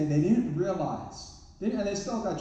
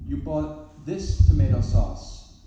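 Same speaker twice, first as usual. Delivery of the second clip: "this" drawn out and loud, slow; stress on this; 8 dB louder, much slower than usual. Retold or imitated. Wife pointedly questioning husband's shopping, stress on "this". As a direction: lean hard on "this" with slow judgement. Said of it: "she emphasized the word "this".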